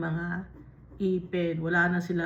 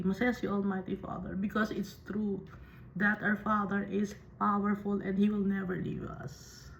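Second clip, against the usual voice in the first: ordinary talk, nothing marked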